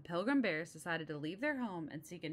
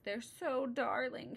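sniffly; 7 semitones higher, slower than usual. Acted. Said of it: "she sniffed".

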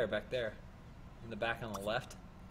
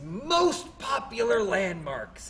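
slow, louder promo voice; mock tour guide; 5 semitones higher, 4 dB louder, slower than usual. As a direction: sell the landmarks like a tourism ad, slowly.

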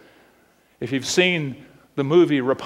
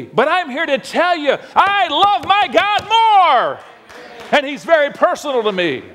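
climbing high on God more; preaching; 11 semitones higher, 3 dB louder than usual. Acted. Preacher telling the church he loves God most, preaching. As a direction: build it up to the word more.

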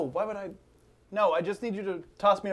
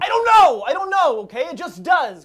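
angry yell; 7 semitones higher, 8 dB louder than usual. Acted. Cornered, snapping back.

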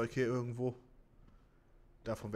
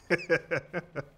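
relieved laugh; 5 semitones higher, 4 dB louder than usual. Real, not acted. Laughs after the escape.

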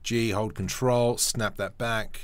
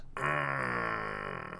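breathy whispered laugh; 7 dB quieter than usual. Real, not acted. After admiring the panel.

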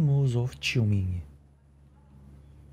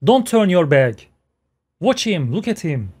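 excited; 5 semitones higher, 6 dB louder than usual. Real, not acted. Thrilled reaction to hero scene.

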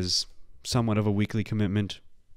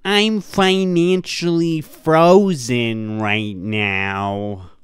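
mock frozen slow; 2 semitones higher, 8 dB louder, much slower than usual. Host mocks the guest's slow frozen delivery. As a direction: stretch every word like you're frozen. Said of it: "sounds like he's actually frozen".

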